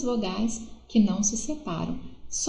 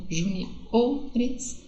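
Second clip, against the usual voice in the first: "Juniores" split up, with a strong O before "res"; stress on second-last syllable. Shown as a demonstration. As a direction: lean on the O: ju-ni-O-res. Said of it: "segunda sílaba forte". ("Juniores" destacando a sílaba forte O)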